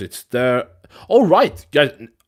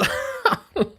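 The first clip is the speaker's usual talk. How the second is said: high giggle. Real, not acted. Surprised at empty chat.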